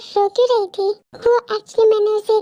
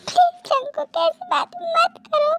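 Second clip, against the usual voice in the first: high, slow, aching plea; distressed pleading; 7 semitones higher than usual. Acted. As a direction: draw the begging out slowly, high-pitched. Woman begs him not to talk so.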